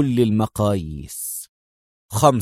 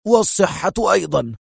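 stern; 6 semitones higher than usual. Acted. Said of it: "في صرامة".

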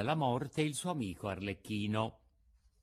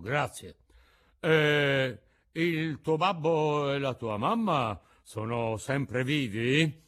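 Mangiafoco's voice; 3 semitones higher, 6 dB louder, slower than usual. A narrator's reading. Mangiafoco questions Pinocchio.

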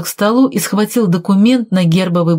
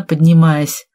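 plain reading voice

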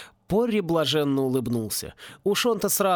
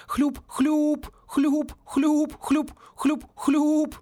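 high excited squelch sounds; ecstatic squelching; 12 semitones higher, much slower than usual. A narrator's reading. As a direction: gush out gleeful high squelches. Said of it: "захлёбывался от восторженного хлюпа".

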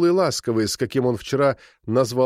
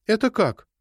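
puzzled; 9 semitones higher than usual. A narrator's reading. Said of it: "озадачилась".

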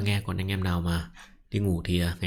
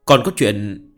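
steady audiobook reading; story narration; 2 semitones higher, 7 dB louder than usual. A narrator's reading. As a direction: read the chapter aloud evenly. Reads novel chapter aloud.

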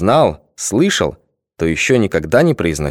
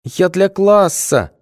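slow, higher, on the verge of tears; tearful excuse; 11 semitones higher, much slower than usual. A narrator's reading. Schoolboy justifying himself before crying, understated. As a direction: plead weakly, about to cry. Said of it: "начал оправдываться ... заплакал".

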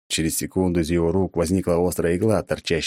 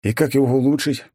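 higher eager question; pressing eagerly, pitched up; 8 semitones higher than usual. A narrator's reading. Hero presses the god, higher and eager.

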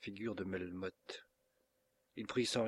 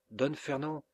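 ordinary delivery

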